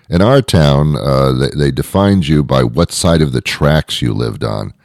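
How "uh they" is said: normal talking voice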